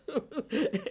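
kichert hoch und vergnügt; hohes Kichern. Acted. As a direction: lacht schadenfroh in sich hinein. Lacht über eigene Geschichte. (vergnügtes hohes Lachen)

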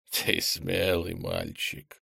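slow, soft creaking voice; creaky monster voice. Acted. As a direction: creak it out with menace. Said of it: "проскрипел Фуруй".

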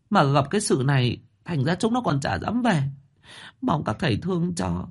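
high, fast, tearful pleading; old mother begging the masters, high and whimpering; whimpering plea. Acted. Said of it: "mếu máo".

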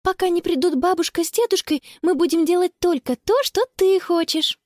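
high-pitched, appeasing offer; promising. A narrator's reading. Sister making it up to her brother, promising. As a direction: coax a child with a promise. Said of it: "пообещала Конни".

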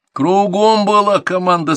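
slow, high, loud reprimand; stern repeated order. A narrator's reading. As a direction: drive the order home, stretching it. Instructor repeats ignored order angrily.

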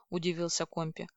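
ordinary delivery, nothing marked

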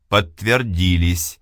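plain reading voice

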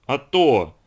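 loud indignant retort; acted burst of indignation; trembling with indignation. A narrator's reading. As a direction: snap back in outrage. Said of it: "дрожа от возмущения".

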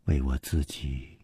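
a slow, low confession; slow and quiet. Acted. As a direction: let it out slowly. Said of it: "缓缓地说".

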